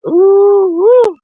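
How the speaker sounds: high, loud exhale of agitation; worked up. Real, not acted. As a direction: blow off steam. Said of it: "I ain't worked up".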